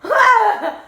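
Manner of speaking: high, loud laughing shriek; a laughing shriek of shock; startled scream. Retold or imitated. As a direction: yelp in fright. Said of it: "I was like".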